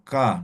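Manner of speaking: ka made at the back of the mouth; velar ka. Shown as a demonstration. Teacher showing where the velar ka sounds. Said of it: "decimos ka".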